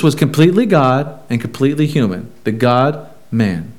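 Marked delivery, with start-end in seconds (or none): none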